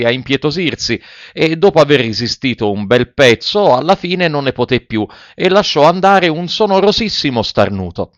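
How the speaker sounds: usual speaking voice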